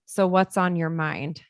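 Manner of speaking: slow open question. Shown as a demonstration. Mom asks her upset kid, slow and gentle. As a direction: leave room for the answer, gently. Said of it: "Another great open-ended question".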